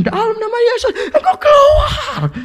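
very high, strained imitation of a screamed command; escalated mock exorcist shriek. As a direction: mimic a frantic exorcist, stretching the last word. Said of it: "tambah kencang".

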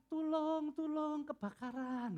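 high-pitched, restrained mock cry for help; gentle Solo speaker. Preacher mimics a Solo person reporting a fire, gentle. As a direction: call out fire far too politely, like someone from Solo. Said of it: "berkata-kata dengan lemah lembut".

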